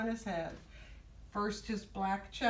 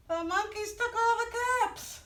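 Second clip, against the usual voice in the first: much higher, dismayed reveal; high-pitched storybook astonishment; astonished storyteller; 14 semitones higher, 4 dB louder than usual. A narrator's reading. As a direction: exclaim the discovery to little listeners. Story reveal voiced for children.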